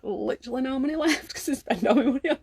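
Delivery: sheepish real laugh. Real, not acted. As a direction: giggle at your own habit. Joking about Subway spending.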